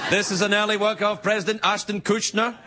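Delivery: high monotone auctioneer patter, creeping upward. Acted. Auctioneer presenting lot, pitch climbing.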